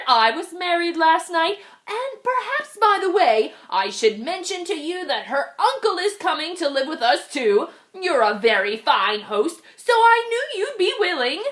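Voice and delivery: a cheeky bird imposing on his host. Acted. Bird goes on.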